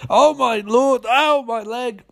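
high, drawn-out mock wailing; pretend pain. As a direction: fake a hurt leg, hammed up. Villain pretends a hurt leg.

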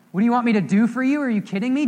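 loud, high, quick mock disbelief; comic outraged incredulity; incredulous. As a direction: repeat the question as if it's absurd. Mocks absurd question to blind man.

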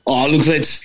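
ordinary delivery

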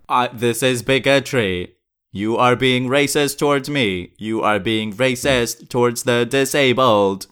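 robotic synthesized speech, evenly paced; computer voice. Acted. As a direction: speak like a talking computer.

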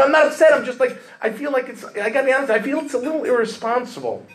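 high, fast protest that he's not upset; indignant denial. Retold or imitated. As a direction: squeak out the denial, plainly rattled. Insisting not upset, clearly upset.

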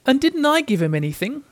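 high-pitched, plaintive question; lightly sad little boy's voice; sad child. A narrator's reading. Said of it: "asked Christopher Robin sadly".

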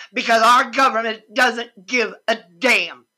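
normal talking voice

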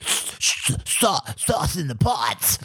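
high duck voice; Donald Duck voice. Pretend. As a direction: quack it like a cartoon duck. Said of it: "what's your impression of Donald".

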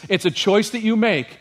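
usual speaking voice

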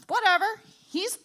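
ordinary talk

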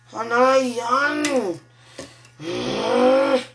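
slow drawn-out tongues; speaking in tongues. Real, not acted. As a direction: stretch out the tongues slowly. Tongues while praying alone.

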